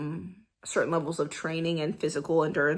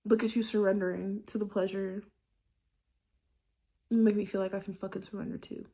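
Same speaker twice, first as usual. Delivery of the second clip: much softer, intimate lover's tone; tender bedroom voice; 6 dB quieter than usual. Shown as a demonstration. Showing her audience a tender bedroom tone. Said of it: "it's like tone".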